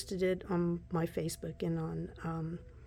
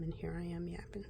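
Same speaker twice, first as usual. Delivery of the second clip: fast, softer whispered aside; quick whisper; 2 semitones lower, 8 dB quieter, faster than usual. Real, not acted. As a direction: toss it off under your breath. Self-mocking aside.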